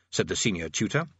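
ordinary delivery, nothing marked

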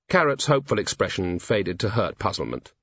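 ordinary delivery

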